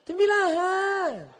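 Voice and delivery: high, slow, sing-song sweetness; coquettish, lilting voice. Shown as a demonstration. Preacher shows the forbidden phone answer, cooing drawl. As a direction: draw the name out in a high sultry swoon. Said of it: "mendayu-dayu".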